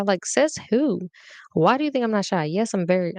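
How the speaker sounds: ordinary talk, nothing marked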